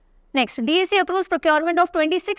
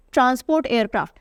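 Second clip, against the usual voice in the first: normal talking voice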